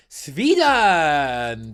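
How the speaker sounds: put-on loud, drawn-out Swedish-accented shout; mock Swedish accent. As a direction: call it out in a comic Swedish accent. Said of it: "That's how they speak".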